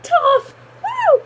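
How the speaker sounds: squealing high cheers for a favourite character; excited cheering. Retold or imitated. Fan cheering Toph while watching the episode, high squealing cheers. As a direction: cheer like a fan leaping off the couch. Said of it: "I was like".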